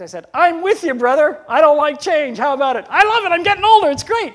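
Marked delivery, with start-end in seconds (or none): 0.3-2.8 high, loud mock-grumbling agreement; jovial griping with an old friend; hearty agreement. Retold banter with brother Earl. 2.9-4.3 high, loud mock enthusiasm about getting older; sarcastic joy, pitched way up and loud; teasing fake delight; ironic cheer. Retold banter, ironic reversal.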